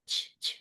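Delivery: "چ" as a bare airy puff; short voiceless "چ" puffs; wrong "ch" sound. Shown as a demonstration. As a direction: show the stray "چ" release. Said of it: "یہ والی sound نہیں".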